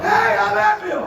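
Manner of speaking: very high, loud, stretched holler; exultant shout. Retold or imitated. Dad to son after miracle shot, exultant shout. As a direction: holler it in triumph.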